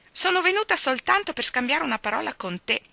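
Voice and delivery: high squeaked little remark; squeaky. A narrator's reading. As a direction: squeak it like a tiny old lady. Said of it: "squittì la signora Curry".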